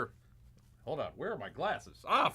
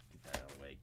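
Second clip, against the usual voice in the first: whispered aside; 17 dB quieter, much faster than usual. Real, not acted. Aside muttered off mic.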